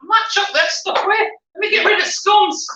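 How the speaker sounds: shrill fussing old dear, hammed up; old lady voice. Old lady bit continues.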